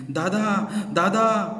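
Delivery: high, loud call while running. A narrator's reading. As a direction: shout for his brother urgently. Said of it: "त्यानं बापूला हाक मारली". (धावताना मोठ्याने हाक)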